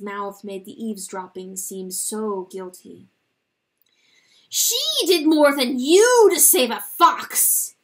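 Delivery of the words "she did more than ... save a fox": high, loud accusing shout; bitter outburst. Acted. Rooster shouting his grief at God. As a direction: hurl it at heaven.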